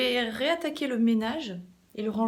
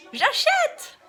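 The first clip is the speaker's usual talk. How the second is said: high, loud eager exclamation; excited; 6 dB louder than usual. Real, not acted. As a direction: blurt it out all thrilled.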